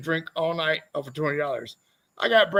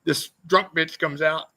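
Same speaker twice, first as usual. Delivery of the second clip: ordinary talk, nothing marked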